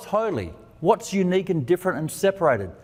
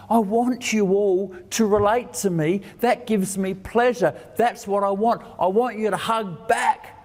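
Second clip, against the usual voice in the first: a hint of a pleading father, pitched higher; lightly voiced longing Dad, higher; 7 semitones higher than usual. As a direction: voice the loving father asking to be hugged. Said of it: "Dad's saying".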